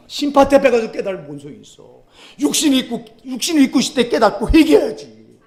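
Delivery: loud, high fervent preaching; preaching at full force. Acted. Preacher warning the congregation, loud and fervent.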